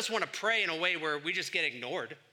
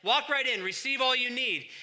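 higher and louder, throwing the door open; eager welcome; 8 semitones higher, 8 dB louder than usual. Retold or imitated. Preacher voicing God beckoning the congregation, eager welcome. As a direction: beckon them in warmly and eagerly. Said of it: "We're beckoned".

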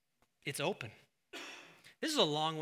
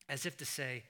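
ordinary talk

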